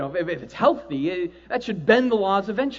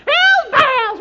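high, loud rock yell; shouted song title, way up high; 9 dB louder than usual. Acted. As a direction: belt it like a metal chorus.

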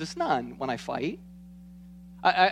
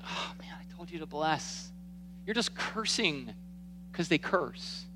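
soft, much quieter, almost whispered disappointment; a hushed head-shaking reproach; disappointed Jesus; 9 dB quieter than usual. Pretend. Jesus gently scolding the pastor for cursing back, hushed. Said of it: "He shakes his head, goes".

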